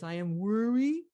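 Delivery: high, loud toddler attempt at "worry"; little kid mispronouncing. Little girl attempting her mother's word, babyish. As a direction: mimic a child who can't manage the word. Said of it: "the little kid can't even say the word worthy".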